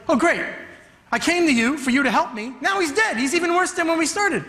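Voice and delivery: a desperate dad complaining to Jesus; a hint of a frustrated father; exasperated father. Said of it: "he's like".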